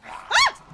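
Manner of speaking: sudden high loud shriek; startled scream. Real, not acted. Jumpy gamer shrieking at a sudden attack, high scream. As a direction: scream at the jump scare.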